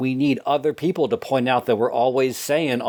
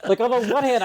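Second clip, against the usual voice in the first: higher, cracking up through the phrase; laughing; 10 semitones higher, faster than usual. Real, not acted. Catching herself saying it again, laughing. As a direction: caught in her own habit, laughing. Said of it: "I'm just gonna go with it".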